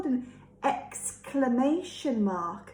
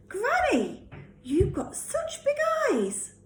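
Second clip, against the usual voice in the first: put-on high little girl voice; 14 semitones higher than usual. Red's high voice.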